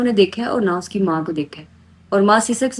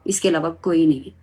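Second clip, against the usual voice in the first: normal talking voice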